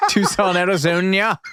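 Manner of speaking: laughing through "Tucson, Arizona". Real, not acted. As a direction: giggle while saying it. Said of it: "I pronounced it like the fucking guy".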